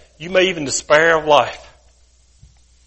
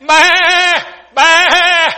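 very high, loud, drawn-out sheep bleat; hollered bleat; 12 semitones higher, 6 dB louder, much slower than usual. Acted. Fallen sheep calling for the shepherd, bleating loudly. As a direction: bleat at the top of your lungs. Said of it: "hollering as loud as he can".

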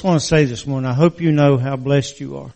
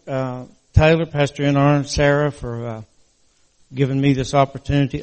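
ordinary talk

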